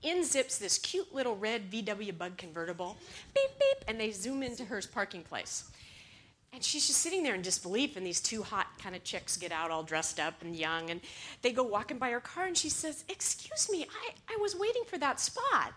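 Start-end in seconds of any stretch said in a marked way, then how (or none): 3.3-3.9 high, loud beep-beep; car horn imitation. Storyteller mimics the cute little convertible, high horn beeps. 13.1-15.7 high-pitched, hesitant objection; polite protest. Acted. Evelyn to the girls who took her parking place, high polite protest.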